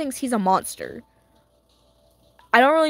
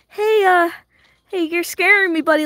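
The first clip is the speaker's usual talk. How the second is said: higher, louder nervous plea; hammed-up fright at a scary friend; mock scared; 7 semitones higher, 4 dB louder than usual. Fan telling Baum he's scary, in mock fright. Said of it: "I was like".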